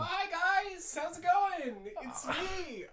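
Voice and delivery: squeaky, sing-song visitor voice; chirpy high-pitched intruder; goofy high character voice. Acted. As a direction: pop in like a cheery cartoon pest.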